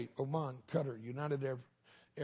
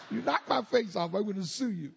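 loud, high comic accusation; mock outrage; 8 semitones higher, 7 dB louder than usual. Burned man threatening the other driver, loud mock outrage. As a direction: threaten loudly in hammed-up indignation.

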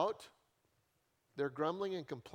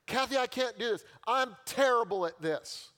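exasperated complaint; 6 semitones higher, 6 dB louder than usual. Retold or imitated. Retold outburst to assistant.

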